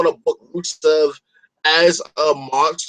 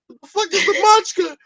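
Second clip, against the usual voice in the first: yelped much higher; shocked friend; 13 semitones higher than usual. Pretend. Friend in the skit shrieking back at the bro, much higher. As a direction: squawk it out in disbelief.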